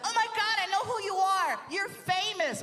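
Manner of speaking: high, squealing recognition; excited girl fan. Acted. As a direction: gush like a starstruck girl, high-pitched. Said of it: "Some girl recognized me. She's like".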